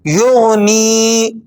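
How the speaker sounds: یغنی with the final ی drawn out slowly; stretched ending. Shown as a demonstration. Teacher stopping on یغنی with a long vowel. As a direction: hold the last vowel long. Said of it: "تھوڑا سا کھینچ کر وقف کر رہے ہیں".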